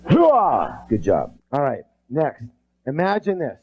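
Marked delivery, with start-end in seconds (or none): 0.1-0.8 loud group chant; loud hoo-ah. Real, not acted. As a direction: shout it out. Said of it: "twice as loud".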